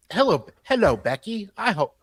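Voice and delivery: lightly put-on British greeting, cheerful; attempted British accent. Reading the reporter's email in a weak British accent. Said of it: "I can't do a British accent".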